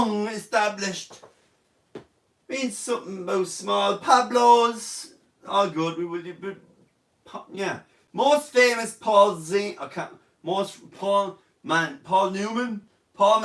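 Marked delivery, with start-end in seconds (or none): none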